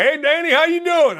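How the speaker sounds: high-pitched mock Chicago greeting; Chicago accent. Host mimicking people at Bloomington, high Chicago accent. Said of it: "there was a Chicago accent".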